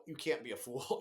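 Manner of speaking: ordinary talk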